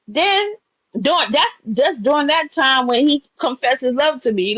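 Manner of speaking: ordinary delivery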